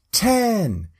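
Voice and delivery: calling out. Retold or imitated. Crowd counting down, calling out.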